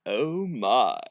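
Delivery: drawn-out, savoring impression; playful celebrity impression; George Takei. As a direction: relish the words like a camp helmsman. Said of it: "That's my George Takei impression".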